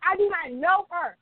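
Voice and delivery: high, insistent indignant retort; snapping, mad as hell. Retold or imitated. Retelling how she snapped at the server's assumption, pissed off and insistent. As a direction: pitch it up and stretch the denial out. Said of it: "I, I just started snapping".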